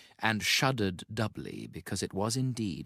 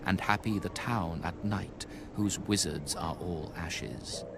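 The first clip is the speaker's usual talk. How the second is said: ominous incantation. A narrator's reading. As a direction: intone it like a curse from an old book. Quoting the Necronomicon passage.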